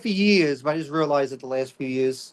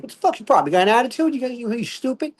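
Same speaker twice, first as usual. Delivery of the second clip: high-pitched mock outburst; snappy inner voice; 8 semitones higher, faster than usual. Comic voicing his first reaction to a friend, snappy. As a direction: act out the urge to snap back. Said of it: "that's my first voice saying".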